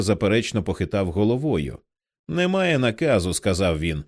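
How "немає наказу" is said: stubborn refusal. A narrator's reading. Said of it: "з тупою впертістю безглуздо твердив".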